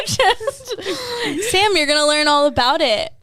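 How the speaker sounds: high, loud, through laughter; real giggly call-out; laughing tease. Real, not acted. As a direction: tease a friend while cracking up. Teasing commenter Sam amid laughter.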